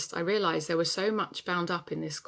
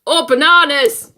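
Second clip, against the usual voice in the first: sudden, much higher, full-throated yell; 9 semitones higher, 18 dB louder than usual. Acted. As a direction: explode at the husband, much louder. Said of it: "I yelled".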